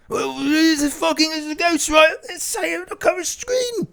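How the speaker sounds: high, excited [name] voice; high [name] insisting; [name] impression. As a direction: insist it's a ghost like [name]. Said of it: "play all three parts".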